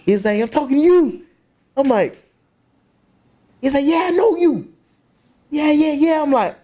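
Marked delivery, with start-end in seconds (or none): 0.4-1.2 high-pitched, insistent mock challenge; confrontational. Acted quarrel in retold story. 3.8-6.2 very high-pitched, insistent challenge; mock accusation. Acted quarrel in retold story.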